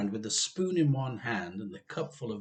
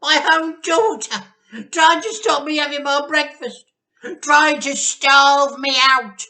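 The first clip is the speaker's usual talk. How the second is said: very high, very loud, slowing whine; Grandma in self-pity; 16 dB louder than usual. A narrator's reading. Grandma complains her daughter is starving her. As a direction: wail it like a martyr. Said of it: "shouted Grandma".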